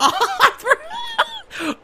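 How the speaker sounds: very high-pitched burst of laughter; high squealing laugh. Real, not acted. Gamer caught not looking, high squealing laugh.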